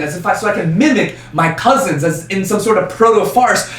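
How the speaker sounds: normal talking voice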